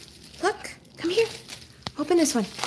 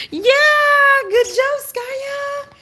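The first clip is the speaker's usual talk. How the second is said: much higher and louder, slow gushing praise; excited dog praise; 14 semitones higher, 6 dB louder, much slower than usual. Real, not acted. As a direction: gush over the dog, stretching it out.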